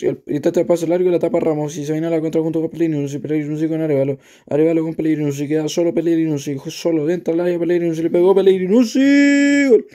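fast, high, loud goal call; breakneck goal commentary. Acted. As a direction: build to a roaring goal shout. Counterattack ends in goal.

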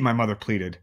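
plain reading voice

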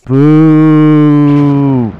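loud mock boo. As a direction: boo the act loudly. Booing the bit.

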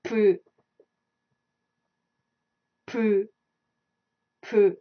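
Poo said slowly, unaspirated, between p and b; pronunciation demo. Shown as a demonstration. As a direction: model the sound Poo for learners to copy. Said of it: "it has a sound of boo".

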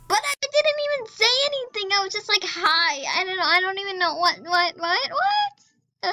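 flustered, voice climbing to a squeal on the last "what"; high-pitched flustered squeal. Real, not acted. Player baffled by a role-play reply, high flustered squeal.

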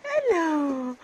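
loud, high hello; excited greeting. Real, not acted. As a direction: burst out a happy hello. Greeting dogs at dawn.

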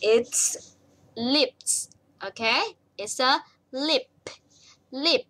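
slow, careful word by word; slow teacher model; slow model answer. Shown as a demonstration. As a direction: spell it out slowly for learners. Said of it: "Look at this".

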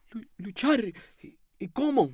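soft, high, stammering question; bewildered lodger. A narrator's reading. Lodger asking the landlady how, timid and halting. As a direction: falter helplessly through the words.

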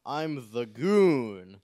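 slow, drawn-out whale call; whale impression. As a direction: moan it like a whale. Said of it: "the whale impression".

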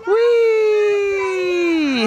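high, loud playful squeal; playful whee. Real, not acted. Playing with the baby.

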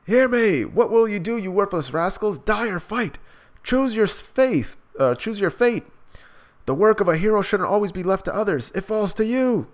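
high, loud heroic battle call; rallying cry. Acted. Betty calling the beaten townspeople to rise up, loud rallying shout. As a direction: rouse the crowd to fight.

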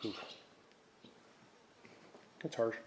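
ordinary talk

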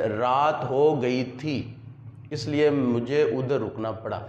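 slow रात हो गई थी, a clear pause, then इसलिए; longer semicolon pause. Shown as a demonstration. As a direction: hold the break before इसलिए a little longer. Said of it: "इसको थोड़ी सी इससे ज़्यादा". (थी के बाद थोड़ा ज़्यादा ठहराव)

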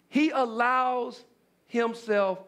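normal talking voice

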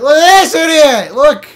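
high, loud mocking shout; Leon mocking. Leon jeering at the player, high loud mock shout. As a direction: jeer it loudly at the idiot. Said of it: "Leon's gonna run up and be like".

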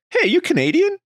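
high, bright-pitched mock question; quizzing hairdresser. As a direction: ask it like a curious hairdresser. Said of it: "my hairdresser was like".

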